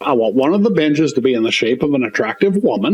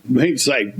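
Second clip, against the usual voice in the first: ordinary talk, nothing marked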